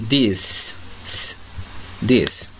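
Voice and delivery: This repeated, crisp and clear; model pronunciation. Shown as a demonstration. Teacher drilling This for students, crisp repetition. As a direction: say This twice, as a drill. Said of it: "A pronúncia desse".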